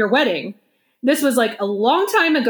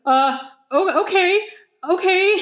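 higher, hesitant okay; retold terror; 7 semitones higher than usual. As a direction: imitate a nervous friend giving in. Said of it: "the sheer terror in his voice".

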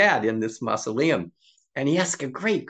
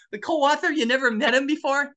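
high-pitched incredulous echo; mock amazement, voice way up; astonished onlooker; 13 semitones higher than usual. Woman amazed co-authors never met, incredulous.

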